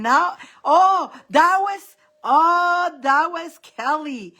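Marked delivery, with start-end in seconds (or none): none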